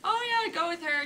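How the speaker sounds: high-pitched, breezily offhand quote; casual. Retold or imitated. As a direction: lightly imitate someone casually waving it through. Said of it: "she's just being casual".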